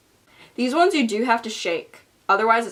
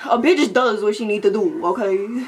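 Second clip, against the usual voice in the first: sassy; 5 dB louder than usual. Pretend. Sassy catchphrase.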